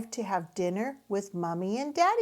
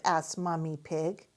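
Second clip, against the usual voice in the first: usual speaking voice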